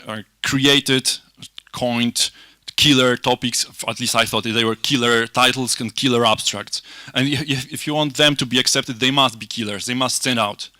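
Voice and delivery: usual speaking voice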